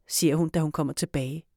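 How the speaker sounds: plain reading voice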